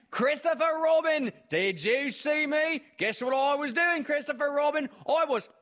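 very high, loud boastful little joey; excited Roo. A narrator's reading. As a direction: show off to each friend in turn.

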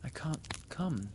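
soft, slow, high apology; apologetic. A narrator's reading. Wendy tells Peter she can't fly away, apologetic. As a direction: refuse gently and slowly, sorry about it. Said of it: "she said apologetically".

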